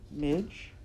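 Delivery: a tiny tremor of unease on the name; slightly shaky. A narrator's reading. Princess appealing to Midge before the knife, faintly fearful. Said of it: "her voice shaking the tiniest bit".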